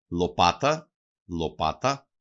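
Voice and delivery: "Λοπάτα" with the weight on πά; penultimate stress. Shown as a demonstration. Teacher shows dialect stress on the second-last syllable, "Λοπάτα" stressed on πά. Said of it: "τονίζουν συνήθως στην παραλίγουσα".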